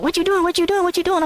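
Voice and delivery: high-pitched, repeated mock protest; imitated protest. Storyteller imitating his homie fighting back, high mock protest. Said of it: "And back saying".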